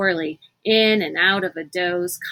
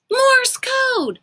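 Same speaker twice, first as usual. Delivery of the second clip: high, loud sudden realization; 4 dB louder than usual. Acted. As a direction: it clicks all at once. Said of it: "Kate said aloud".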